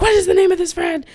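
high, loud mock interrogation; retold yelled question; imitated yelling. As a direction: fire it off like a shouting cop. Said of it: "yelling them at him".

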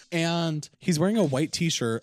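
ordinary talk

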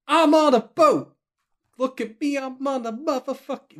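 high, slow shouted meme chant; yelling. Pretend. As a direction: belt it out like a party anthem.

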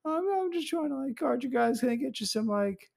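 high, meek, eager-to-please voice; mock Jeremy voice. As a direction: talk way up high like a nervous kid. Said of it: "And he was like".

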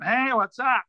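lifted, put-on comic's drawn greeting; Norm Macdonald. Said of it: "I guess I will do him".

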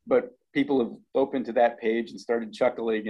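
usual speaking voice

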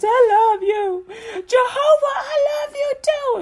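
high, loud, hammed-up crying to Jesus; mock sobbing prayer. Praying person cries love to Jesus, mock tearful wail. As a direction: wail it out like an overcome worshipper. Going back and forth with Jesus.

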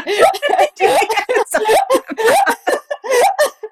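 high, helpless laughter; long laughing fit. Real, not acted. Comic laughing hard at her own crepe answer. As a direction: let the laugh roll on.

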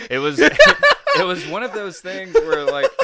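high-pitched laugh through words; cracking up mid-sentence. Real, not acted. Laughing at disinheritance story.

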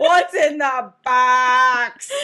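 slow, loud, laughing movie yell; Se7en quote. Retold or imitated. Co-host echoing the quote, loud and laughing. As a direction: drag out the desperate film line. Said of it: "Calm down, Brad Pitt".

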